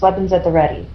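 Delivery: ordinary delivery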